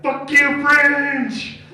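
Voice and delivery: high, loud taunting shout; Carl's loud retold taunt; mock yell. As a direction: yell it back at the car. Carl yells at car.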